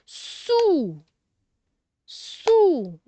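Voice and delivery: "Sou" high and held, falling at the end; teacher sounding out the syllable "Sou"; isolated syllable. Shown as a demonstration. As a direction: stretch "Sou" on its own, then repeat it. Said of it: "Alors, on commence".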